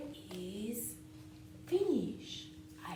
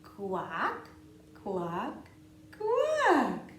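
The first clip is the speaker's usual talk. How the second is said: duck quacking; 8 dB louder, much slower than usual. Pretend. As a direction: quack like a playful duckling. Duck refrain.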